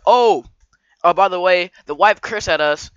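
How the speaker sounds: a hammed-up kid complaint, loud and high; loud tattling. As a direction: tell on her loudly to the grown-ups. Kids loudly telling on the wife.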